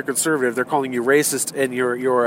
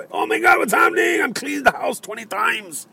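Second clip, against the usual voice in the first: high, shouted panic; wired and frantic in jest; mock frantic tweaker; 9 semitones higher than usual. Drugged marcher panicking while cleaning, high frantic shout. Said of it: "Not like".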